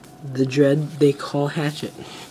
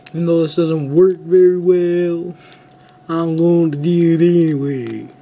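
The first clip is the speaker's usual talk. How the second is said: put-on Western accent; 3 semitones higher, 6 dB louder than usual. Kid in a toy review doing a Western accent. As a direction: play a cowboy with a Western twang. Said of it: "I just had to do that Western accent".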